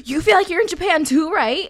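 high, loud, amazed question; eager drunk imitation. Drunk guy at Japanese bar seeking agreement, loud eager imitation. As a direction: blurt it out like a thrilled drunk.

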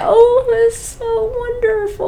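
very high, drawn-out mock coo; fake gushing praise. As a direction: gush with hammed-up delight. Mock praise for child's scribbles.

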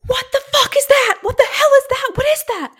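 high, loud alarmed yelling; panicked screaming. Retold or imitated. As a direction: wake the room in sheer alarm. Retelling his panicked sleep-talk screaming.

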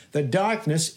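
ordinary talk, nothing marked

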